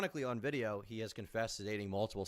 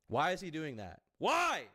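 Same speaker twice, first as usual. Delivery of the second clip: pitched-up exasperated challenge; exasperated why; 4 semitones higher, slower than usual. Real, not acted. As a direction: demand an answer in disbelief. Exasperated rhetorical questioning.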